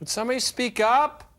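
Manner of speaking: high, loud heckle; mock exasperated shout. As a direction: holler like someone hard of hearing. Can't hear the whispering film actor.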